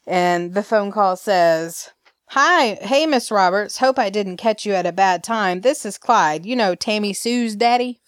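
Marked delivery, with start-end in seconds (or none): 2.3-8.0 a lightly put-on Alabama accent, a bit higher; playing Clyde with a mild Alabama twang. Clyde on the phone to Kate, in a mild Alabama accent. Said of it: "did y'all like my interpretation".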